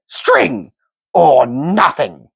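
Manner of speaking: high, slow creature shriek. A narrator's reading. Gollum shrieking his double guess at Bilbo. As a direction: shriek both guesses at once. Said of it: "shrieked Gollum".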